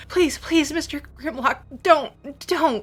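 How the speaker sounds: put-on high pleading child's voice; young girl begging the monster. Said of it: "she's begging".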